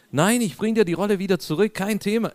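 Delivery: hammed-up innocent reassurance; mock eager reassurance. Preacher mimicking himself to a suspicious usher, mock overeager.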